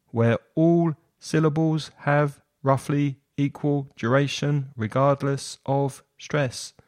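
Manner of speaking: "where all syllables have roughly equal duration" in evenly timed, level syllables; syllable-timed rhythm. Shown as a demonstration. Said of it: "syllable-timed rhythm".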